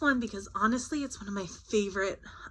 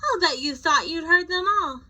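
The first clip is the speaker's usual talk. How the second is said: ordinary delivery, nothing marked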